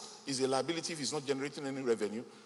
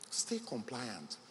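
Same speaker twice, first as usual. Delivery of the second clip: slow, much softer repeat of "Stay compliant"; repeating the key advice to entrepreneurs, softly; soft echo; 5 semitones lower, 8 dB quieter, much slower than usual. Shown as a demonstration. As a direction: drop to a near-hush on "Stay compliant". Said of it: "please remember this".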